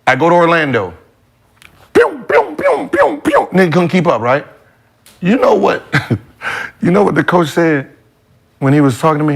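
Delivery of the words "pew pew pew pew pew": rapid high-pitched "pew" sound effects; playful high laser-like pews; high zooming "pew" noises. Retold or imitated. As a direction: imitate zipping past with squeaky pews. Imitates himself zooming past the others.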